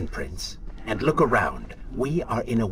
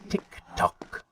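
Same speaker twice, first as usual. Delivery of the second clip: Tick-tock whispered, softer and breathy; Tick-tock as a sly whisper; whispered taunt; 8 dB quieter than usual. Acted. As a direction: hiss out Tick-tock quietly.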